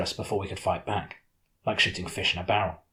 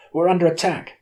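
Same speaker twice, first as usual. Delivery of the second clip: much higher and louder alarm cry; shout; 10 semitones higher, 7 dB louder than usual. Acted. A crewman shouts the alarm to everyone aboard. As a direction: yell the alarm across the deck. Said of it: "shouted someone".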